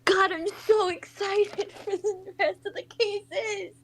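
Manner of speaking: high-pitched squeal of real excitement; thrilled, laughing squeal; excited gushing. Real, not acted. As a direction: gush like a giddy fan, voice way up. Gushing over favourite game cases.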